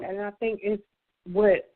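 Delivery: normal talking voice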